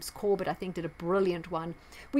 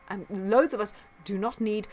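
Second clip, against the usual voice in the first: ordinary delivery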